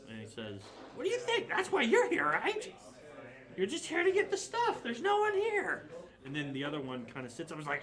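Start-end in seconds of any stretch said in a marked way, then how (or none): 0.9-5.9 very high, squeaky goblin voice; indignant goblin. Acted. Goblin justifying looting to intruders, indignant squeal. Goblin answers about the loot.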